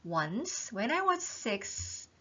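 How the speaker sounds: very slow, deliberate read-aloud; slow text reading. Shown as a demonstration. Teacher reading the opening line aloud very slowly. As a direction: read each word slowly for learners. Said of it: "제가 오늘 본문 보여드리겠습니다".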